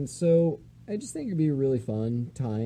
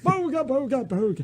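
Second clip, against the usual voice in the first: Eustace voice; 9 semitones higher, 3 dB louder than usual. Retold or imitated. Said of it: "That's Eustace with my old man voice".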